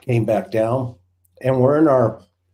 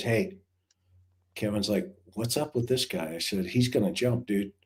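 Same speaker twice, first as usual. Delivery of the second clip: hushed aside; 4 semitones lower, 10 dB quieter than usual. Retold or imitated. Quiet talk with cop partner.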